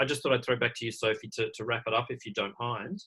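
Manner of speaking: ordinary talk, nothing marked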